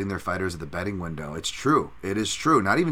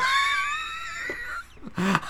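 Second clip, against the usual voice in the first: high shouted laugh. Real, not acted. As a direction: crack up at your own bit. Laughs at own Canadian impression.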